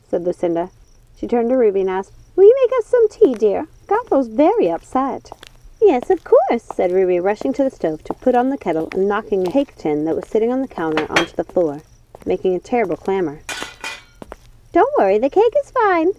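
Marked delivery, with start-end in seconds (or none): 2.4-5.3 high, louder fussy witch voice; fussing witch. Acted. Lucinda asks Ruby for tea. 5.8-6.6 high, eager witch voice. Acted. Ruby hurries to obey. 14.7-16.1 high, flustered witch voice. Acted. Ruby reassures after clamor.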